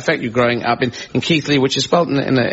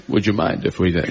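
a hint of Connery's Scottish purr, pitched lower; Sean Connery impression; 6 semitones lower than usual. Host retelling the Campbell story, doing a Connery voice. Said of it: "I have to do Sean Connery".

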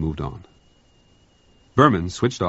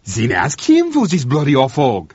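louder, higher, the cook scoring a point; triumphant; 8 semitones higher, 9 dB louder than usual. Acted. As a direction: crow it in triumph. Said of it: "said Blanchard triumphantly".

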